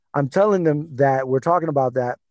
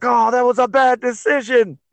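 high-pitched mock outraged yell; retold yell; 9 semitones higher, 3 dB louder than usual. Fan yelling out in the bar at a bad decision, retold yell. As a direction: cry it out in loud protest, retold. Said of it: "I yell out in the bar".

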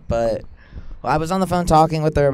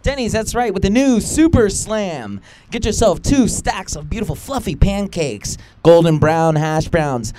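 ad read; 5 dB louder than usual. Pretend. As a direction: sell it like a TV breakfast ad. Mock sponsor ad read.

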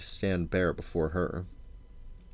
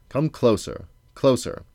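louder, higher, insistent summons; grumbled order; 6 semitones higher, 5 dB louder than usual. A narrator's reading. Old king squinting at strangers in the dark, grumbled order. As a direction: urge them nearer, impatiently. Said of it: "he grumbled".

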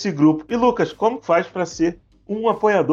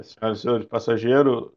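pilot over the radio; 6 semitones lower than usual. Retold or imitated. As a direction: announce it like a bored airline pilot. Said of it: "comandante de avião, ele tem sempre uma pausa".

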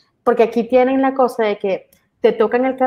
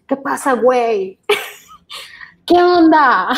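slow, drawn-out mock tough greeting, breaking into laughter; a pretend rough-street "qué onda"; tough Mexican attitude; 4 semitones higher, 3 dB louder, slower than usual. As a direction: act hard and unbothered, then crack up. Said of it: "le dices".

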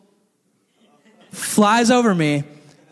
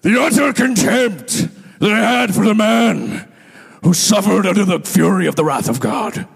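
Ken Graves impression; 4 dB louder than usual. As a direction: boom it out like a fiery pastor. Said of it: "I'm gonna do a perfect, perfect impression".

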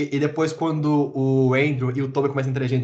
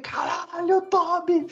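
much higher, thrilled cry of the name; excited yell; much slower than usual. Retold or imitated. Recalling the cinema to co-hosts, high thrilled yell. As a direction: cry out the name like a fan seeing a hero return. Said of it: "Comecei a gritar assim".

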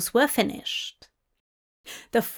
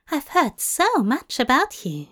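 ordinary delivery, nothing marked

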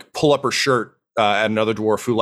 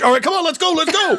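very high, squealing hurry-along; giddy excitement; faster than usual. Acted. Starstruck dwarf urges the visitors inside, giddy. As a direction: rush them in, bursting with glee.

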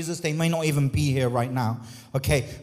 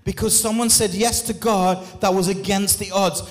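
rising, louder preacher's call over music; preaching at full force; 4 semitones higher, 3 dB louder than usual. Acted. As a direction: lift the congregation, building loud and high.

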